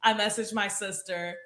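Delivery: ordinary talk, nothing marked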